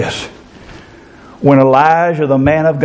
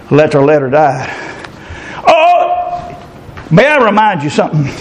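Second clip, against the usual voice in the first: ordinary talk, nothing marked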